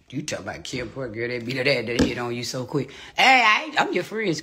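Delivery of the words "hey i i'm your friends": excited. Real, not acted. High excited greeting.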